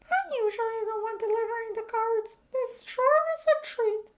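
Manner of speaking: very high, bright woman voice; mail carrier. A narrator's reading. Mail carrier thrilled to get a card, very high. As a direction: squeal with delight. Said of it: "said the mail carrier".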